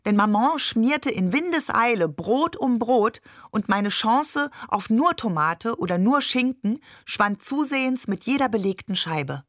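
ordinary delivery

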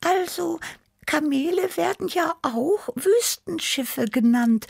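very high, squeaky cat voice, helpful; high little creature, siding with a friend; little cat Tibi. A narrator's reading. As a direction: chip in like a small kitten. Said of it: "sprang mir Tibi bei".

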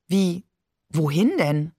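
mildly irritated question; understated irritation. A narrator's reading. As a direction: ask back, puzzled and a touch annoyed. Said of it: "fragte sie irritiert vom Sofa aus".

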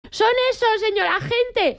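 mucho más aguda, chivándose a gritos; señala a los culpables con orgullo; grito triunfal. Retold or imitated. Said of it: "cuando llegaron grité". (la vecina grita a la policía desde la ventana)

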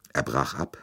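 plain reading voice

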